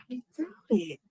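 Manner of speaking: whispered under the breath; hushed doubtful whisper. Real, not acted. Musing to himself while watching.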